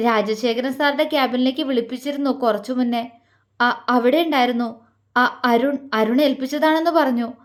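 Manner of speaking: usual speaking voice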